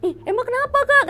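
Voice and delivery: high, loud frightened whine; getting scared. Retold or imitated. Frightened intern asking the senior nurse why, high whine. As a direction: squeal it as fear creeps in. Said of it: "jadi takut dong".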